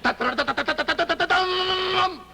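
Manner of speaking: high, drawn-out da-da fanfare; sung movie fanfare. Retold or imitated. Comic showing how the film's music opens, imitated fanfare. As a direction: trumpet out a rising film-score flourish. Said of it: "the first thing you hear is the music".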